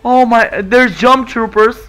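amazed at each new sight; excited disbelief. Real, not acted. Gamer spotting troopers, loud excited disbelief. Trailer reaction.

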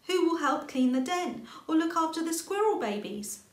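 high, loud anxious rabbit; pleading for Squirrel; worried little rabbit. A narrator's reading. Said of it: "said Little Rabbit".

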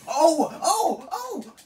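rising high loud shouts of alarm; excited yelps. Real, not acted. Getting comboed by the boss, high excited yelps. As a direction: yelp with each hit taken.

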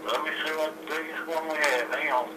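usual speaking voice

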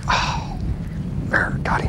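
hushed whisper as he hooks the fish. Real, not acted. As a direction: breathe it out under your breath. Hooking a fish quietly.

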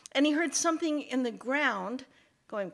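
ordinary delivery, nothing marked

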